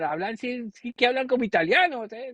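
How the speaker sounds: high, quick Castilian mimicry; imitated Spain accent. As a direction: imitate a Spanish woman exclaiming in Spanish. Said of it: "the accent from Spain".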